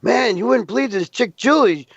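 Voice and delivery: a friend at the gas station bragging; excited coworker. Retold or imitated. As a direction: brag about the girl who called. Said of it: "He says".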